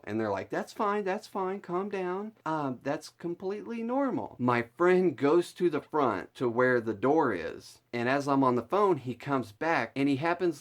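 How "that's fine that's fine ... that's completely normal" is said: high, softly soothing voice; calming dispatcher. Retold or imitated. Nine one one operator to frightened caller, calming. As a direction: imitate an operator talking a caller down.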